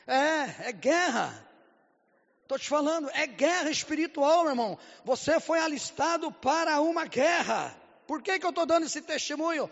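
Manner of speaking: preaching at full force. Acted. Preacher urges the church about spiritual war, fiery preaching.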